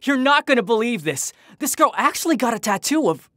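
high, eager call of his name; excited. Acted. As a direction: burst in with news you can't hold in. Bursting in with big news.

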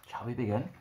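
usual speaking voice

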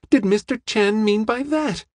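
high-pitched, bewildered question; amazed cry. A narrator's reading. Young woman asks what detective meant, amazed high cry. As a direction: keep the astonishment high to the end. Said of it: "she cried".

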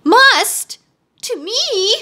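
very high, indignant exclamation; pitched far up, affronted princess; outraged screaming. A narrator's reading. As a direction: shriek it in haughty disbelief. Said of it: "screamed the princess".

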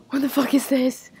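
sudden loud frustrated swear; angry outburst. Real, not acted. Gamer yelling at his screen, annoyed shout.

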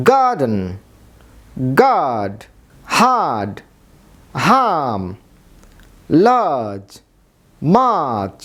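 each English word said slowly and clearly with falling tune; word list demo. Shown as a demonstration. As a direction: model English words, one at a time. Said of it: "এরকম আরও অনেক শব্দ রয়েছে যেগুলোতে আপনি silent আর পাবেন". (ইংরেজি শব্দ আলাদা করে স্পষ্ট উচ্চারণ)

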